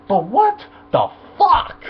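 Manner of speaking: high, slow, drawn-out disbelief; fanboy venting in disbelief at the dance scene; incredulous. Real, not acted. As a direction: stretch it out in exasperation.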